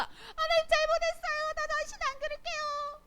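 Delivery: high, loud mock pleading; acted apology. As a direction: beg forgiveness in a squealed, put-on high voice. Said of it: "하나님께 잘못을 구하는 거". (높은 목소리로 과장되게 용서를 비는 연기)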